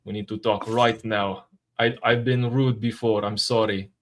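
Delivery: a hint of a Russian accent, apologetic; lighter Russian accent. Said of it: "How do you do that?".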